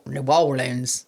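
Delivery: low Cajun accent on "New Orleans". Shown as a demonstration. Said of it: "Or if you're in the Cajun accent".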